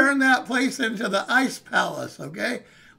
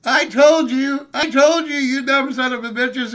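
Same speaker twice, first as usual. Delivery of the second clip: put-on yelling old man; 4 semitones higher, 8 dB louder than usual. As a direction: shout it from a passing car window. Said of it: "yells out at all of us".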